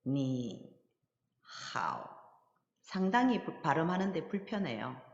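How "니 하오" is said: 니 drawn out low, 하오 dropping lower after a pause; by original tones. Shown as a demonstration. Teacher repeating 니 하오 uncomfortably by written tones, both low. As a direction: say 니 and 하오 as two separate low third tones. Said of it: "성조대로 한번 읽어볼게요".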